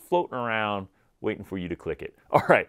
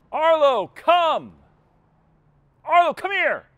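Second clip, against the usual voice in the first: very high, loud holler for the dog; screamed dog command; 5 dB louder, slower than usual. Acted. Owner calling an unresponsive dog out in public, screaming. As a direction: scream the call as if it must carry far. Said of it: "scream at 'em".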